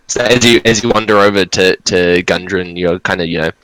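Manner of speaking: ordinary talk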